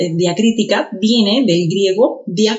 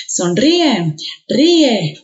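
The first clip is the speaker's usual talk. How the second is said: Sonríe, ríe with a forceful RÍ-e; Sonríe, ríe, each í split from the e; stress on the í; 2 semitones higher, much slower than usual. Shown as a demonstration. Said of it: "se puede pronunciar con mayor intensidad".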